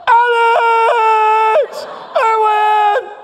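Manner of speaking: high, loud shouted triumph; screaming. Retold or imitated. Delbert screaming to his brother on the ride, high loud shout. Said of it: "He's screaming".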